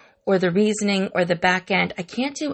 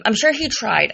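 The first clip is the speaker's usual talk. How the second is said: ordinary talk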